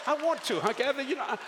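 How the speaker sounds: high, laughing confession; embarrassed laughing admission; laughing through it. Real, not acted. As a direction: talk through the giggles. Laughing while admitting he can't cry.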